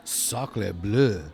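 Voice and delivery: mock French exclamation; French accent. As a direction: say it like a cartoon Frenchman. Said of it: "I can't affirm or, or stand behind any words that you say in another language".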